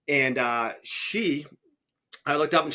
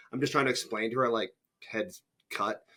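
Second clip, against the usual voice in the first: ordinary talk, nothing marked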